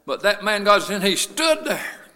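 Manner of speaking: loud sermon rising to a high shout; building preaching. Real, not acted. Preacher telling how God was watching him, building to a shout. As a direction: build steadily into full-force preaching.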